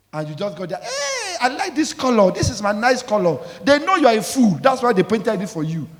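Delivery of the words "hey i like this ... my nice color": high squealing mock delight; mock excited buyer. Preacher mimicking a naive buyer gushing over a car's color.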